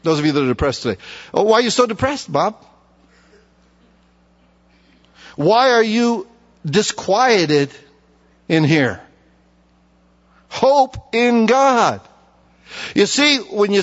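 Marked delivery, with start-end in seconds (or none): 1.3-9.0 self-talk demo. Shown as a demonstration. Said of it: "put your name in it". 10.5-12.0 stretched, reverent and slow; slow, drawn-out Hope in God. Shown as a demonstration. Preacher urging the congregation, drawn out slowly. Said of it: "So put your name in it".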